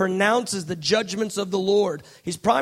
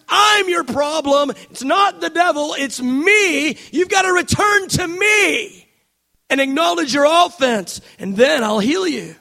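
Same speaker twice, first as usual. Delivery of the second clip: God's rebuke; 3 semitones higher, 4 dB louder than usual. Acted. Preacher voicing God rebuking the church, building to a shout.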